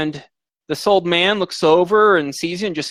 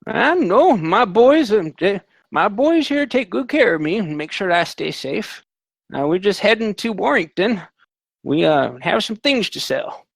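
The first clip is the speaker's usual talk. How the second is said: put-on higher, cheerful old traveller voice; lightly voiced kindly old man; 5 semitones higher than usual. GM voices old man about sons.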